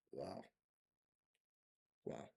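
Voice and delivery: ordinary talk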